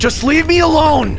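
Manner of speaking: high, loud panicked cry; panicked yell. Acted. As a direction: scream to get away. Shoplifter fleeing employee.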